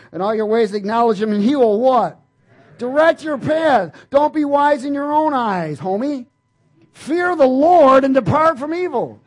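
loud, high, slow scripture proclamation; full-force preaching. Acted. Preacher preaches Proverbs three loudly to the congregation. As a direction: drive every line home to the church.

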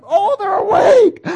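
high, loud, slow thrilled gasp; excited. Retold or imitated. God the father thrilled his child is awake, high and loud. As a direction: burst with joy as the child wakes. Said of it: "He's excited".

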